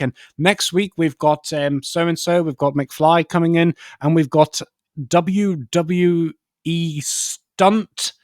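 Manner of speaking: chirpy sign-off from a host who doesn't get it; clueless kids' TV presenter. Retold or imitated. Kids' show host wrapping up before the end credits, chirpy and confused. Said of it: "the presenter, um, was like".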